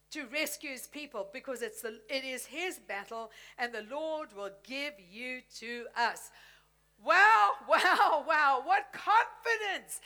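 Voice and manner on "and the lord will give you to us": ordinary delivery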